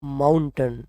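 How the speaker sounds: माउंटेन with a reduced ending, "MOUN-tun"; correct pronunciation. Shown as a demonstration. Teacher models the word for learners, correct pronunciation. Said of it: "right one is mountain".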